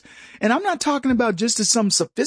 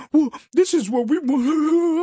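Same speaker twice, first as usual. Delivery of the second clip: mock voice; 8 semitones higher than usual. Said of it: "and they say".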